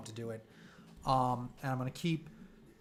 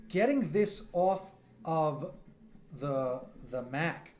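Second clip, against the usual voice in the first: halting slow emphasis on Getting; slow, drawn out; much slower than usual. Real, not acted. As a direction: drag the words out while thinking. Stretching out a tech plan.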